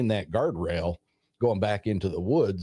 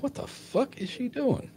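baffled; 4 dB quieter than usual. Retold or imitated. His baffled inner thought.

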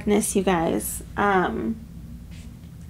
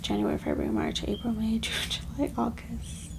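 very soft, half-voiced month count; counting under her breath; 11 dB quieter than usual. Real, not acted. Reader working out the ninth month for herself, muttered softly.

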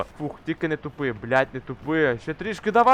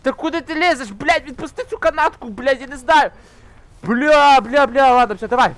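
much higher and louder frustrated yelling; raging shout; 10 semitones higher, 8 dB louder than usual. Real, not acted. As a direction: blow up at the game. Raging at climbing rope mechanic.

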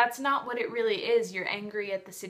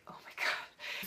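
awed whisper; 9 dB quieter than usual. Real, not acted. Overwhelmed by the book's ending.

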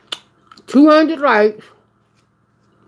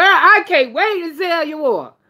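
high-pitched, excited Southern accent; strong drawling accent; 9 semitones higher than usual. Real, not acted. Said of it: "Look at that accent".

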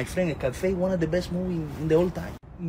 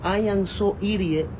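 mock tattoo reading. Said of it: "a tattoo right here that say".

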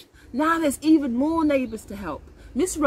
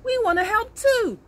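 higher, keen chorus of helpers; eager neighbours; 10 semitones higher, 4 dB louder than usual. A narrator's reading. Neighbours offering to join in, eager. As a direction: pipe up eagerly, pitched well up. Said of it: "Most say".

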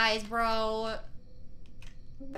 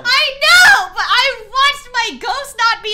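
high, loud outraged complaint; exasperated yell; 9 semitones higher, 13 dB louder than usual. Real, not acted. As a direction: shout the grievance out. Ranting about his death.

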